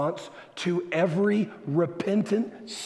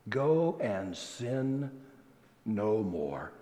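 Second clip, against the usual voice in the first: slow, soft "no more", dropping low; solemn correction; 7 semitones lower, 6 dB quieter, much slower than usual. Shown as a demonstration. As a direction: give "no more" quietly with a pause before it.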